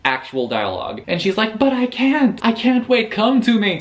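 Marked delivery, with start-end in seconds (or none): 1.6-3.8 much higher, hammed-up begging girlfriend; mock swooning plea, pitched way up. Reviewer voicing Haley begging Ryan, hammed-up and much higher.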